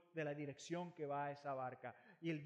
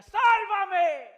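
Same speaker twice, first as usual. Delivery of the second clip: high, loud scream for help; desperate cry; 22 dB louder than usual. Retold or imitated. Sinking Peter screams to Jesus for help.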